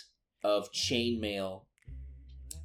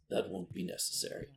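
cold quiet aside; 9 semitones higher, 7 dB quieter, faster than usual. Acted. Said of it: "he says".

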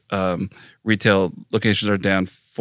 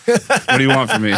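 laughing exasperation; 13 semitones higher than usual. Real, not acted. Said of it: "mock exasperated, laughing".

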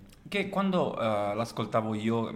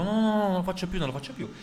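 pleading priest; 8 semitones higher, faster than usual. Acted. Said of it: "il prete scongiura".

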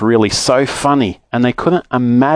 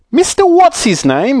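high, astonished exclamation; excited cry; 4 dB louder than usual. Acted. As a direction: cry the name out high in delight. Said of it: "he cried".

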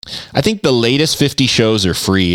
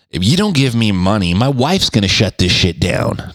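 usual speaking voice

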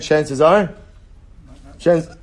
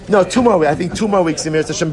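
higher and louder, animated; excited correction; 6 semitones higher, 7 dB louder, faster than usual. Real, not acted. Teacher correcting the schedule for the class, animated and loud. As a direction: correct yourself with a burst.

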